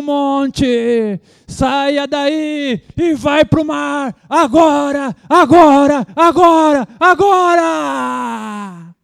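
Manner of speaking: high, slow, shouted commands to the mountain; booming pentecostal rebuke, building to a yell on agora; hammed-up preacher commanding the mountain; mock voice of authority. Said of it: "Fazer uma voz mais, mais de autoridade".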